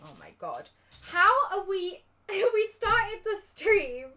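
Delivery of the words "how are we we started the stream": loud, high, slow laughing words. Real, not acted. Laughing at lack of progress.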